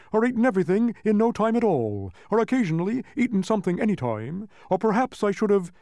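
high, loud rambling humbug; flustered wheezy bug. A narrator's reading. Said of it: "wheezed the uncomfortable bug between gulps".

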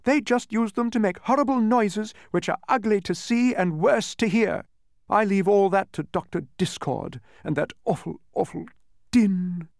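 high, loud, disgusted tirade; heated outburst of disapproval; indignant cry. A narrator's reading. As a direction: rail against the noise, leaning on each insult. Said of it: "she cried".

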